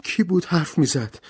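high-pitched frightened question; terrified. A narrator's reading. Frightened woman asks about the mysterious voice, terrified. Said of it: "ورا وحشت زده گفت".

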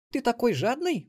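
put-on high girl's voice, surprised question. A narrator's reading. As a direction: ask in high-pitched astonishment. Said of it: "удивилась внучка". (удивлённая внучка, высоким голосом)

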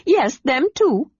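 high, eager chatterbox; bubbly little adventurer; excited kender voice. A narrator's reading. Tass cheerfully agreeing.

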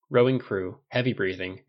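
ordinary delivery, nothing marked

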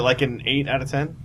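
laughing out the question; cracking up mid-teasing question. Real, not acted.

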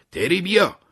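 high, loud shout calling Terry to come; loud urgent shout. A narrator's reading. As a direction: yell it out from the jeep, urgent. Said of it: "دیو داد زد".

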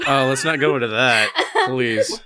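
pleading through real laughter; laughing plea. Real, not acted. Asking co-host to drop the subject, laughing. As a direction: beg them to drop it, still laughing.

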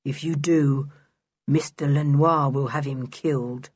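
droned, expressionless threat; curious monotone. A narrator's reading. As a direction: deliver the threat all on one note. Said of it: "in his curious monotone of a voice".

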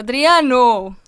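loud reproachful call of a name. Real, not acted. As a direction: shout the name in mock outrage. Calling out at a joke.

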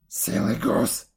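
slow, low, put-on witch voice; scolding witch. A narrator's reading. Witch scolding Gretel at the oven, slow and contemptuous. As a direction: draw out the insult as the old witch.

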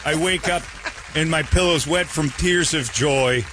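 high, slowing mock-sentimental Chicago accent. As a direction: confess lovingly in a hammed-up Chicago accent. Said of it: "he's got a Chicago accent".

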